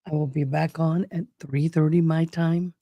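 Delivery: ordinary talk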